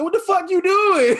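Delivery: high, loud startled yell; high loud startled shout with a laugh. Retold or imitated. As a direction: yell it like you just got spooked. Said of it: "I said".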